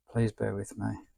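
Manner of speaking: ordinary talk, nothing marked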